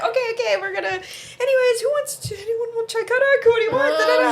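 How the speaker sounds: brightly changing the subject; cheery dad. Retold or imitated. Dad defusing the heated argument, high and cheery. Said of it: "He was just like".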